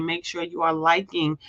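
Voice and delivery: ordinary delivery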